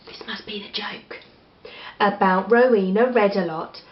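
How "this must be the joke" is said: soft unvoiced aside; hushed storytime aside; whisper. Real, not acted. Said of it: "reader aside whisper".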